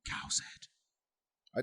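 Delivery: very soft, unvoiced title reveal; spooky whisper. Repeating the story title for the co-host, spooky whisper. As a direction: whisper it eerily, barely audible. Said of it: "I can't hear you".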